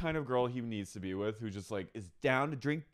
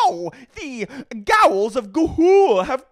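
cringe millennial voice; 11 semitones higher, 13 dB louder, slower than usual. Retold or imitated. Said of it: "making fun of millennial cringe videos".

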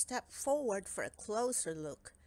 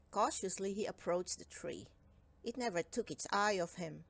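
ordinary delivery, nothing marked

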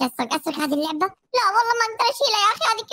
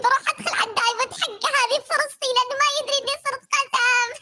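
usual speaking voice

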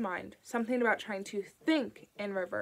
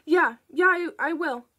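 much higher, louder stammering rush; sudden urgency; 7 semitones higher, 9 dB louder than usual. A narrator's reading. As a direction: blurt it before the line is cut. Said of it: "his voice quickened with sudden urgency".

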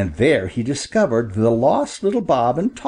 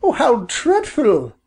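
high, dismayed mother bird; high-pitched dismay; crying out in dismay; 13 semitones higher than usual. A narrator's reading. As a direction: cry out in storybook alarm, pitched high. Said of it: "cried Missus Bob".

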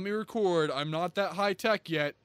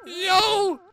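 high, loud whiny cries; half-crying protest; wailing distress; 12 semitones higher, 13 dB louder, slower than usual. Real, not acted. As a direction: wail over lost petals. Rose losing petals.

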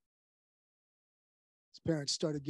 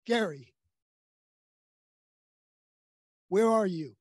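louder, higher call into the dark building; crying out; 4 semitones higher, 8 dB louder than usual. Retold or imitated. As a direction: call out for a lost son, loud and high. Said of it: "started crying out".

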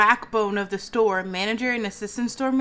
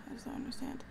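"I just don't understand" in a breathless whisper; exhausted whispered repeat; 17 dB quieter, much faster than usual. Real, not acted. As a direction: fade it to a whisper.

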